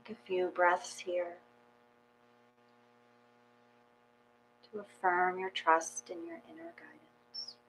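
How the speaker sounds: soft, unhurried meditation guidance; guided meditation. Acted. Yoga teacher guiding the class into calm, soft meditation voice. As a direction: ease the class gently into stillness.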